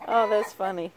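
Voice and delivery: normal talking voice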